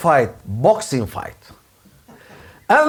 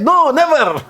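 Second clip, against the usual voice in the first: defiant; 7 semitones higher than usual. Acted. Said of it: "but inside".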